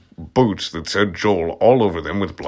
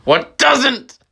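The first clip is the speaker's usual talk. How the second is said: incredulous aside; 4 dB louder than usual. Real, not acted. Reader mocks the story's Marilyn Manson picture, high and amused. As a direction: squeak it, pitched way up in mock disbelief. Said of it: "aside at the text".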